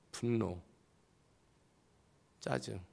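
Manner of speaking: ordinary delivery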